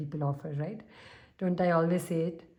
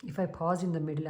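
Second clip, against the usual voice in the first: ordinary talk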